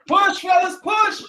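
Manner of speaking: high, forceful push call; urging shout. Real, not acted. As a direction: drive them through the last seconds. Coach urging final seconds.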